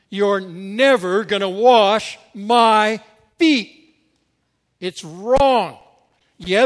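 acted disciple's indignant protest; defiant comeback. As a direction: dig in and flatly refuse. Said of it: "You notice his comeback?".

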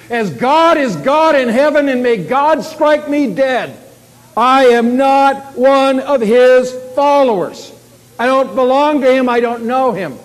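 shouted, high, slow and emphatic; vehement oath. Acted. Peter swearing he doesn't know Jesus, vehement shout. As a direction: swear it at full voice. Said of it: "he combined that with oaths and curses".

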